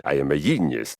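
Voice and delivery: ordinary delivery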